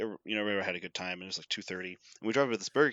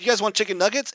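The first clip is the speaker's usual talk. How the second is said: higher, bright offering; eager offer; 8 semitones higher, 3 dB louder, much faster than usual. Retold or imitated. Worker offers the students nuggets, higher and eager. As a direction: push the nuggets on them gleefully.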